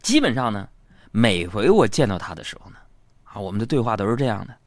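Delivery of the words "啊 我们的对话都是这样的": ordinary talk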